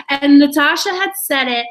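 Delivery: ordinary talk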